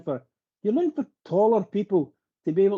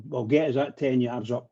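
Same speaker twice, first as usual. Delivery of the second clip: usual speaking voice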